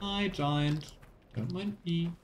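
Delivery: ordinary delivery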